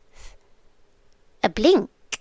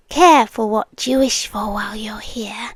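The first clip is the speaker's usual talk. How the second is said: ordinary talk, nothing marked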